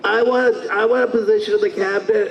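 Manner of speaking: high, put-on cartoon demand; cartoon character voice. Favorite character demanding a cabinet post, cartoonish. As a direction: plead in a silly high character voice. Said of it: "he's like".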